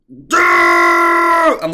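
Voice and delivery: loud, high yell at himself; exasperated self-directed cry; frustrated shout. Real, not acted. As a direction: shout at yourself for missing it. Kicking himself at the memory.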